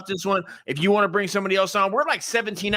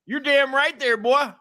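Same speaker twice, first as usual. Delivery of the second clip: put-on country accent; 7 semitones higher than usual. Co-host inviting liberals down to his farm, hammed-up country accent. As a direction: ham up a good ol' boy Southern accent. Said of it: "you should talk like that".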